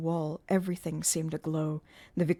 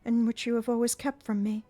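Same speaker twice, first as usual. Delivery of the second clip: put-on higher, lightly playful girl; forced playful; 6 semitones higher, 5 dB louder than usual. A narrator's reading. Christine to Eric, forced playful higher voice. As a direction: try to sound playful over fear. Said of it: "a tone which she tried to render playful".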